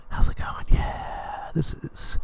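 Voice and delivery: hushed whispered greeting; whispering. Acted. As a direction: whisper it to the viewers.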